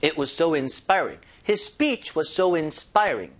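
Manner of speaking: "It was so inspiring" stretched out word by word, very slow; slow model reading. Shown as a demonstration. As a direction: read it out slowly and clearly for learners. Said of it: "inspiring 했던 거죠". (학습자를 위해 아주 천천히 또박또박 읽기)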